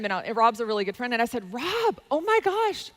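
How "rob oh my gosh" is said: high-pitched, drawn-out excited exclamation; retold excitement. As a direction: act out excitedly grabbing a friend's attention. Retells excitedly pitching her friend.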